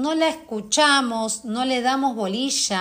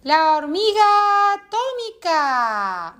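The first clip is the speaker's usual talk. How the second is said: high, loud, drawn-out cartoon superhero call; Hormiga Atómica; 7 semitones higher, 4 dB louder, slower than usual. Shown as a demonstration. Coach demonstrates the funniest childhood cartoon voice, high comic cartoon cry. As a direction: stretch it out high and loud like a funny cartoon character. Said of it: "la de la hormiga atómica, ¿cómo era?".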